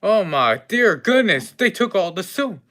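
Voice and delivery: high, loud dismay; shocked exclamation. Acted. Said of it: "I was like".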